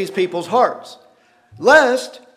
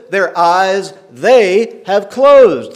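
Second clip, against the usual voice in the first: their eyes they have closed stretched very slowly and louder; slow emphasis; 3 semitones higher, 7 dB louder, much slower than usual. Shown as a demonstration. Preacher stressing the key line to the congregation, slow and loud. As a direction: lean hard on the whole phrase, slowly. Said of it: "listen to this".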